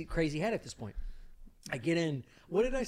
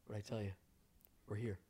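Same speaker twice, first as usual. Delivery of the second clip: low, soft, unhurried imitation; very calm; 8 semitones lower, 15 dB quieter than usual. Friend turning round at the hotel corner, very calm. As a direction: say it quietly with total composure. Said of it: "very calmly".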